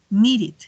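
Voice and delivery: "needed" in two syllables, ending like "it". Shown as a demonstration. Teacher contrasts need and needed, "needed" ending in "it". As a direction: give "needed" a clear second syllable. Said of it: "la pronunciación es como un it".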